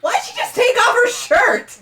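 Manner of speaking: high, loud, shocked question; shocked shout. Real, not acted. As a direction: yell it in disbelief. Shocked reaction to game scene.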